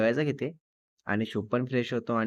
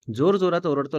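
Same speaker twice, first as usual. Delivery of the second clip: ordinary delivery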